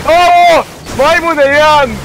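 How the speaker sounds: startled high yell; surprised shout. Real, not acted. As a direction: yelp out in surprise. Game shock.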